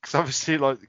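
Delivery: ordinary talk, nothing marked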